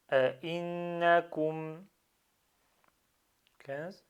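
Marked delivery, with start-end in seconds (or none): 0.1-1.8 أئنكم in one unbroken flow, doubled n held; joined up. Shown as a demonstration. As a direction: link all of أئنكم without a break. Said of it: "j'enlève la barrière".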